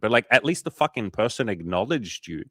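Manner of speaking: ordinary delivery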